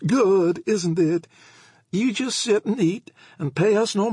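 put-on high, loud rattling old lady; chatty old woman. A narrator's reading. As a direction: prattle on eagerly. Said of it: "Missus Howlett rattled on".

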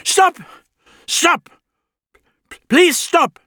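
high, loud pleading shout; desperate calling out. A narrator's reading. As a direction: call out begging them to stop. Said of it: "another voice called out".

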